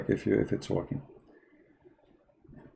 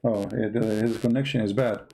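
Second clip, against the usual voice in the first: ordinary delivery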